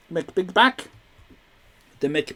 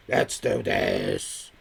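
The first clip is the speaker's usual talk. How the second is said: deep hype voice; 5 dB quieter, much slower than usual. Acted. Hype-up before the question.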